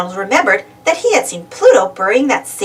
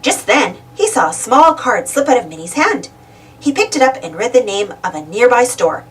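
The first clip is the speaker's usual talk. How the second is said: ordinary delivery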